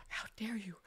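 lightly hammed indignation; mock indignant. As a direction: play the wounded ego, a touch. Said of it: "might be getting a little bit like".

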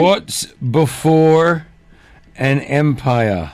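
very slow, drawn out while working on the wording; careful wording. Real, not acted. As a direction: stretch each word, pausing before "an empire". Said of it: "I just wanna get the wording right".

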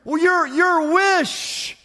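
high, emphatic pledge of eager service; fervent devotion. Acted. Rescued man on his knees offers to serve his saviour, fervent pledge. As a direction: pile up promises with overflowing gratitude.

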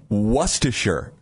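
Worcestershire said slowly, WUSS-tuh-sher; careful attempt. Shown as a demonstration. Host answers co-host's challenge, slow careful Worcestershire. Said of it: "Can anybody say that word right?".

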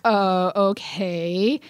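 slow, drawn-out, unsure acknowledgement; imitating an awkward, stalling reply; hesitant acceptance. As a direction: stretch it out as if stumped. James's awkward reply to wrong cat.

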